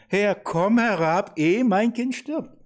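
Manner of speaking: loud, high, slow imploring cry; desperate plea. Acted. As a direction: cry out urgently, drawing out each word. Reading the official's desperate plea aloud.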